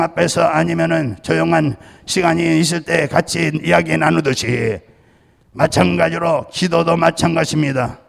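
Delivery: firm, ringing pulpit delivery with cadenced endings; preaching. Acted. Pastor preaching on hearing God to his congregation.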